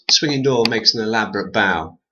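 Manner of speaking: ordinary delivery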